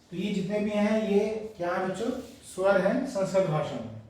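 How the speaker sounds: ordinary talk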